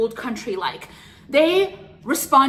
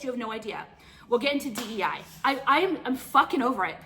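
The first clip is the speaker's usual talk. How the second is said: usual speaking voice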